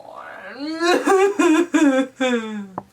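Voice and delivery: acted shuddering bear sobs; mock blubbering. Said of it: "the bear blubbers on".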